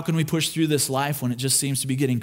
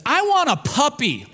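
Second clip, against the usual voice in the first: put-on little girl voice; 11 semitones higher than usual. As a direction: beg like a small girl who only wants a puppy. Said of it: "Lina jumps in and says".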